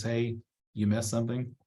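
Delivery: normal talking voice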